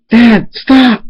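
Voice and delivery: high, loud scream to stop; shrieked, pleading cry; screamed plea. A narrator's reading. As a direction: scream it out at Dad, pitch way up. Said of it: "Schooler screams in his head".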